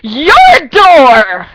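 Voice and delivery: high loud your door; mocking shout. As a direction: yell it back as a taunt. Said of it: "my friend Billy goes".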